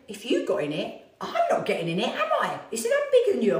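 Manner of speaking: high, loud laughing mock protest; husband's protest. Husband refusing to try the wetsuit, high laughing protest. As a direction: squeak out the refusal through laughter. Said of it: "And he went".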